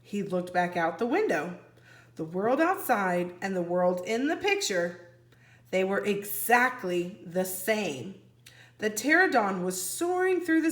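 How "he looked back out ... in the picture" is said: ordinary delivery, nothing marked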